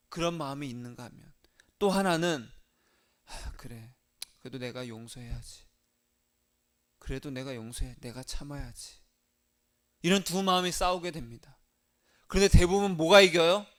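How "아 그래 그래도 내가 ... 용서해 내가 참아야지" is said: low, hushed inner voice talking itself round; resigned self-talk. Acted. Believer telling himself to forgive an enemy, quiet and resigned. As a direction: softly give in, as if thinking aloud.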